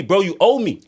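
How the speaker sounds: usual speaking voice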